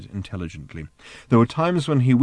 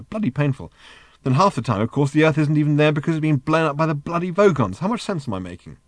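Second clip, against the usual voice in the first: higher, fretful English everyman; Arthur, exasperated; 6 semitones higher, 4 dB louder than usual. Acted. Arthur to Ford about his search for Earth, exasperated. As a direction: rattle off his grievances in a rush.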